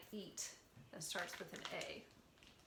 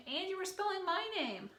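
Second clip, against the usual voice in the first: excited praise; 10 semitones higher, 6 dB louder than usual. Real, not acted. Teacher praising child's name spelling.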